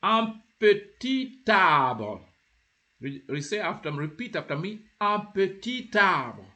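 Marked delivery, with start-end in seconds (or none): 0.0-2.2 slow, higher, louder "un petit arbre", T carried onto "arbre"; joined T. Shown as a demonstration. Teacher demonstrating when you join the petit, T joined. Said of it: "you have joined the T of the petit with the A". 5.0-6.4 slow, higher "un petit arbre" with T opening "arbre"; joined T. Shown as a demonstration. Teacher models for learners to repeat after her, T joined. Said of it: "you have joined the T of the petit with the A".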